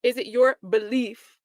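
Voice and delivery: imitating the lawyer's sarcastic jab; sarcastic mock. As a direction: drag out "belief" with scorn. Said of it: "with an air of sarcasm".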